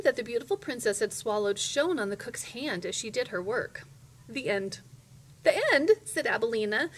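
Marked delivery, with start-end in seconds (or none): 5.4-5.9 high, loud indignant question; indignantly. A narrator's reading. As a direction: echo it in outraged disbelief. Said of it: "Abilene indignantly".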